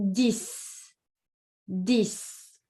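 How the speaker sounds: dix ending in S; dix, final X said as S. Shown as a demonstration. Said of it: "the X is pronounced like S".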